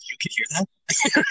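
shocked. Retold or imitated. Shocked realization retold.

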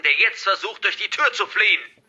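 high, loud villain warning; shouted threat. Acted. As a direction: drive the threat home at a shout. Hacker's threat builds to shout.

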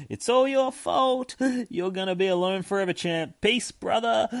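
high-pitched mocking farewell; taunting departing dad. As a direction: throw blame in a high, put-on voice. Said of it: "montage of Chris's dad leaving".

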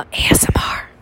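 unvoiced whisper. Shown as a demonstration. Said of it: "you guys get some".

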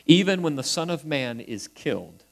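usual speaking voice

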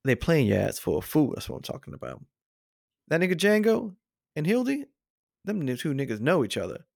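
put-on voice of a scheming informer; [name]. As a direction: act out an emphatic tip-off. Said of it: "[name], what are you talking about?".

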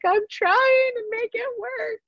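pleading through tears; tearful plea. Retold or imitated. Crying to doctor that she's trying, tearful. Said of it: "I'm like".

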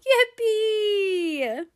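high gleeful yippee; excited cheer. Pretend. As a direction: squeal it with joy. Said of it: "throw up your arms, and say".

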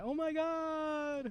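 put-on very high, very slow British accent, amazed; drawn-out British gasp. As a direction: stretch a British "Oh my God" way up high in awe. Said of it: "his British accent".

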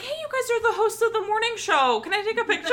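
high, giggly starstruck fan; excited fan voice. Retold or imitated. Fan asking the morning show hosts for a picture, high and giggly. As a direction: gush like a fan spotting celebrities. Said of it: "Amanda's like".

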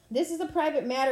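loud booming command; bellowing. A narrator's reading. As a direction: bellow it at the crowd. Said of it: "Ares bellowed".

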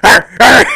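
too-loud mock roar. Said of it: "Sorry if that was too loud".

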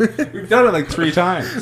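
giggling through the words; laughing. Real, not acted.

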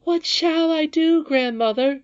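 very high, loud, drawn-out wail; sobbed. A narrator's reading. Princess turns to grandmother in despair, sobbing wail. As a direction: wail it slowly like a sobbing child. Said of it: "sobbed the princess".

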